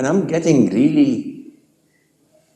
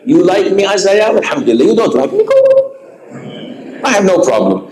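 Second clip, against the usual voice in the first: rising higher to a sharp high go; defiant; 8 semitones higher, faster than usual. Real, not acted. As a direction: toss it off, defiant, up and up. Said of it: "defiant reply to critics".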